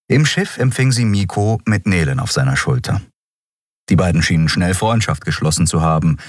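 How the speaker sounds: plain reading voice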